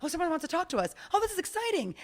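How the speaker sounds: chatty skin cells waking up, growing excited. Pretend. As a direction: wake up and get thrilled. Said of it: "the cells are like".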